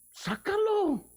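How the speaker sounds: very high, sharp command; Jesus commanding. Acted. Jesus telling the crowd to pluck out the eye, stern command. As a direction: cry it out as Jesus' stern order. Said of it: "Que dijo".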